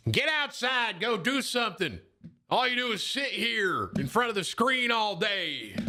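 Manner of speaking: high, drawn-out scolding dad voice; mock nagging dad. Dad to a gaming kid, exaggerated high-pitched nagging. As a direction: imitate a parent ordering the kid outside. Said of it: "He doesn't talk like that".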